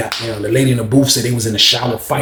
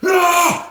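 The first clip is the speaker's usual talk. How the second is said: high yelp of alarm; sharp cry. Retold or imitated. Kurt cries out.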